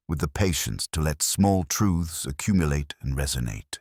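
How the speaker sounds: usual speaking voice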